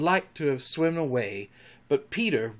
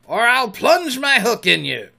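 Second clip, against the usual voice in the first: high, loud shouted threat; menacing bellow at the crew; crying out; 9 semitones higher, 8 dB louder than usual. A narrator's reading. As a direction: threaten like a pirate captain. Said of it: "cried Peter".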